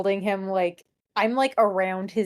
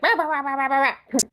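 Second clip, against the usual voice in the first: mock ranting; 5 semitones higher, faster than usual. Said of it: "He's like".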